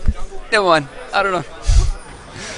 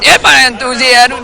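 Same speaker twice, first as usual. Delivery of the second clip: higher, louder pumped-up reply; enthusiastic; 7 semitones higher, 4 dB louder than usual. Shown as a demonstration. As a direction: summon fake hype on cue. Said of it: "Where's my enthusiasm".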